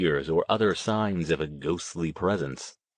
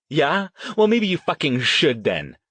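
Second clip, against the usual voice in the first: higher, heated comeback; angry retort; 7 semitones higher, 4 dB louder than usual. A narrator's reading. Petrucci before storming out on Duncan, angry retort. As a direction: dare him to go ahead.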